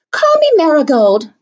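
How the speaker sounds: Jo, eager and bright; eager girl. Acted. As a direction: ask it eagerly. Child eagerly renames herself.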